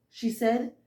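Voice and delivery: usual speaking voice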